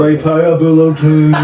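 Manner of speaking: loud, high, stretched-out word; comic catchphrase voice. Acted. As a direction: draw the word out big and silly. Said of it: "without saying it like that".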